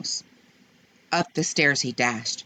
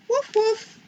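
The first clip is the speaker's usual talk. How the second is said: puppy bark. Acted. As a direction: bark like a small eager puppy. Puppy barks.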